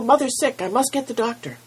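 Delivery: put-on high, loud panicked boy; urgent cry. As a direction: shout it over his shoulder while running. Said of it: "cried Milo".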